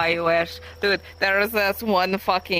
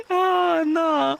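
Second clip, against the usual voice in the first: laughing; 12 semitones higher than usual. Real, not acted. Laughing at the joke.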